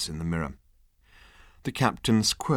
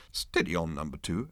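low, soft, calm rebuke; captain calming his officer; gentle admonishment; 4 semitones lower, 8 dB quieter than usual. Acted. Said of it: "admonished the captain gently".

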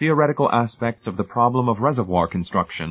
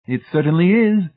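high, loud, jovial official; beaming director voice; 10 semitones higher, 5 dB louder than usual. A narrator's reading. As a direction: beam at the new hire. Director agrees.

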